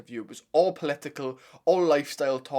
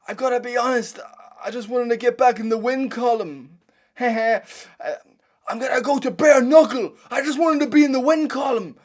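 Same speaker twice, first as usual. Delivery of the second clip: much higher, louder mock Irish post-fight voice; imitating McGregor on the mic; 10 semitones higher, 9 dB louder than usual. Fan imitating McGregor's octagon interview, high and loud. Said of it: "I can hear him on the mic already".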